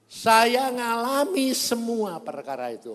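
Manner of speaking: high, loud, slowed proclamation; ringing, drawn-out testimony; preaching testimony. Real, not acted. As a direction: declare it at full force to the congregation. Preacher testifies at full force.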